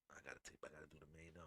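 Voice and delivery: whispered to himself. Real, not acted. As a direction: breathe it out, no voice. Muttering while setting up clip.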